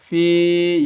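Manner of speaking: فی chanted with a stretched vowel; ya madd. Shown as a demonstration. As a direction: hold the "ee" of فی long and level. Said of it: "یا مدہ ہے یہ".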